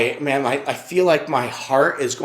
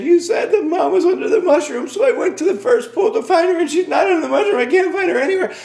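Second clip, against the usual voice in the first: put-on very high, loud kid wail; sobbing child; 5 dB louder, faster than usual. Lost son explaining to his dad, mock sobbing child. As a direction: imitate a distraught little boy blurting it all out. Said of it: "he is sobbing".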